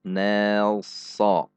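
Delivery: "nelso" drawn out thin to heavy; "nelso" lengthened only to show the change; stretched illustration. Shown as a demonstration. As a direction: slide slowly from thin "ne" to heavy "so". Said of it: "hanya ilustrasi saja".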